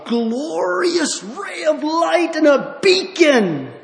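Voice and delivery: soaring high, loud and slow; preaching burst. Real, not acted. Preacher lifting the congregation toward the light, soaring shout. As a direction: cry it out with mounting fervor. Preacher's rising vision of glory.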